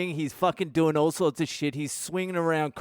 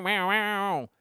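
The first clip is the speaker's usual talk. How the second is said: imitated revving engine, higher than speech; motorbike engine; 6 semitones higher than usual. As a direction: voice a dirt bike revving in the distance. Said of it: "motorbike engine just going".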